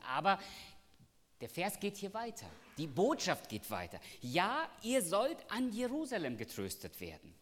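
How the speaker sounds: high, loud preaching voice, driving the promise home; full-force preaching. Acted. Preacher proclaiming God's promise to the church, high and loud. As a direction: preach it out to the congregation.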